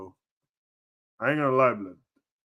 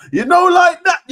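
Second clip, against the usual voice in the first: high, loud hyped yell; excited shout; 14 dB louder than usual. Real, not acted. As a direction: shout it out in delight. Streamer hyped about wrestler.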